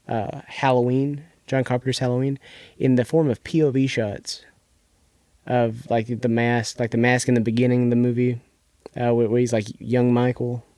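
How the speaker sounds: ordinary delivery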